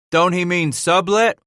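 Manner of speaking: high, loud questioning interjection; interrupting. A narrator's reading. Trapper correcting a name, interrupting loudly. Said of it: "one of the others interrupted".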